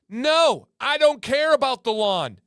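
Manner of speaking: loud, high-pitched outburst; emphatic denial. Real, not acted. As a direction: shoot it down at full voice. Emphatically rejects the lawn-guy role.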